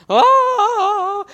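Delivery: high, loud "Oh!" of a doting mother; a mom's squeal of delight over her son's first haircut; mother cooing over the boy's shaved head, squealing; delighted gasp. Retold or imitated. Mom kissing son's new haircut.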